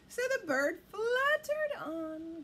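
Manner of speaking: usual speaking voice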